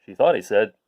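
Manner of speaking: ordinary delivery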